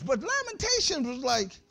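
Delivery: ordinary delivery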